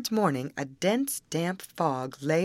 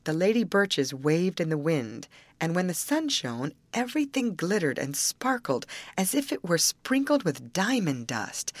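ordinary delivery, nothing marked

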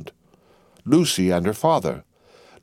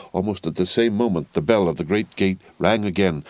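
usual speaking voice

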